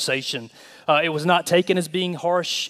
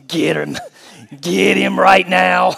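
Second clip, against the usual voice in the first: a slow, loud, gleeful get him; cheering on the scolding; egging on; 7 dB louder, slower than usual. Retold or imitated. Bystander egging on a mom scolding a smart-mouthed kid. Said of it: "to yourself muttered under your breath".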